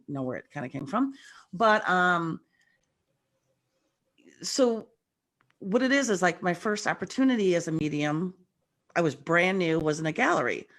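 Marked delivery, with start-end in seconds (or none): none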